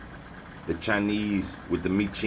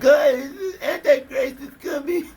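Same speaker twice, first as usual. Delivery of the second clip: high, laugh-cracked fake terror; mock panic; 6 dB louder than usual. Mocking frightened viewers with a shrieking laugh-cry. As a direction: parody a scared believer wailing. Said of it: "I know right now you're scared".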